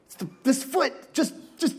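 a mock fussy parent; impatient parent. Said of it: "I swear".

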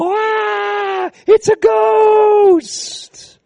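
high, slow panicked shriek of fear; terrified shout. Retold or imitated. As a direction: cry out in terror at a ghost. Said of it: "they go".

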